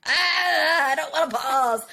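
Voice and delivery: whiny complaint. Retold or imitated. Mom admitting she resists pausing, high whine. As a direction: whine it like a cranky kid. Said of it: "and I'm like".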